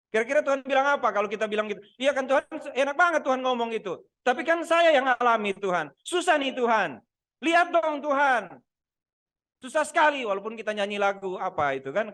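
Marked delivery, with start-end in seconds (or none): 2.0-10.2 mock whining. Jemaat merengek kepada Tuhan.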